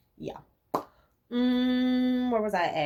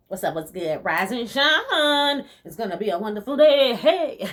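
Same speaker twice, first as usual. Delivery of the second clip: cheery wake-up greeting; 4 semitones higher, 4 dB louder than usual. Retold or imitated. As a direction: greet the day like an overly cheerful alarm. Imitating her early-morning cheerful self.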